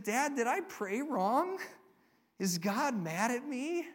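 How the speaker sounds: lightly acted hurt child; imitating upset child. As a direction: ask like a worried six-year-old, without real crying. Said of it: "he burst into tears".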